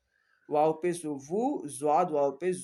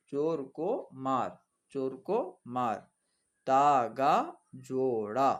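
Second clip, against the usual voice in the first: slow, word-by-word dictation read aloud; much slower than usual. Acted. Teacher dictating practice words to students, slow and deliberate. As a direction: spell it out slowly for the class to follow.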